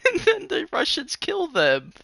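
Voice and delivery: high, loud, laughing disbelief; cracking up while telling it, pitch and volume climbing. Real, not acted. Telling a friend the grim ending of a news story, laughing.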